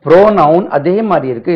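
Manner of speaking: ordinary talk, nothing marked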